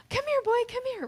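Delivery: high, bright-eyed call to a dog; high excited "come here, boy". Shown as a demonstration. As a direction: call the dog like it's the best moment ever. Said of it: "get your voice, like, excited".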